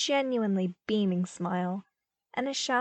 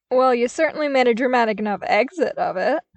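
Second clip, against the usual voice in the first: bright teasing jab; cheerful; 4 semitones higher, 8 dB louder than usual. A narrator's reading. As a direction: chime in cheerfully. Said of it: "chimed in cheerfully".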